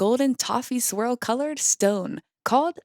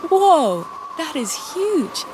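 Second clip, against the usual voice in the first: amazement; 4 semitones higher than usual. A narrator's reading. Nick staring up at giant temple statues, amazed. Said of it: "asked Nick in amazement".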